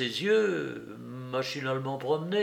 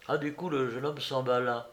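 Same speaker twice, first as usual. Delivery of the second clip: ordinary delivery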